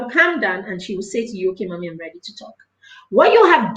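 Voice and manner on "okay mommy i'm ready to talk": put-on soft, fast little child's voice; calmed child. Calmed child telling mommy she's ready, soft and quick.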